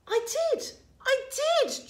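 put-on high, loud childish insistence; shouting. A narrator's reading. George insisting to disbelieving Grandma, shouting. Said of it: "George shouted".

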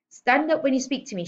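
high, loud sharp order; snapped. A narrator's reading. Headmistress orders small girl to stand, snapping. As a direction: snap out the command. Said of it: "she snapped".

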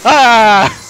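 sharp scared yelp; startled scream. Real, not acted. Jump scare.

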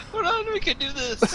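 high, helpless laughter breaking up the words; laughing. Real, not acted. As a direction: try to rally the team while cracking up. Laughing fit during a tense game.

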